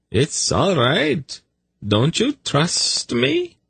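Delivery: slow, high, coaxing tone; reassuring. A narrator's reading. Zadi winning back his snake's trust, reassuring. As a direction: wheedle a sulking pet slowly. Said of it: "Zadi assured her".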